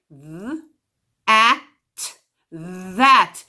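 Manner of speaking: that built up th, at, that; sounded out that. Shown as a demonstration. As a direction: sound out that piece by piece. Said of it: "Let's try it".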